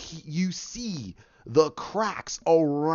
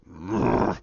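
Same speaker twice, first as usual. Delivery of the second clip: low beast growl; short, deep monster growl; Hulk; 10 semitones lower than usual. Retold or imitated. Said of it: "And he goes".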